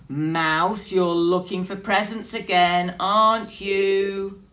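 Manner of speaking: loud knowing accusation; accusing. Acted. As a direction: catch him out loudly. Bear catching Mouse out.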